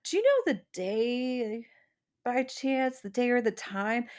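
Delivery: high, sweet, overly polite voice; innocent asking. Retold or imitated. Dreamer innocently asks Will the day, high and sweet. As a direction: play dumb and ask sweetly.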